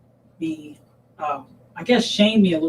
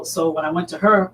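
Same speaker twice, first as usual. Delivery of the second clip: ordinary delivery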